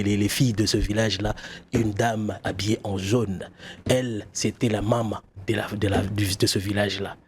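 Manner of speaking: story narration. Acted.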